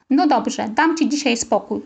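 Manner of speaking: ordinary delivery, nothing marked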